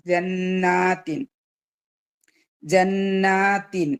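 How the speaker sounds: ജന്നാത്തിൻ very slowly, with a long aa; two-count long vowel. Shown as a demonstration. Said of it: "രണ്ട് count നീട്ടാനുള്ളതാണ്".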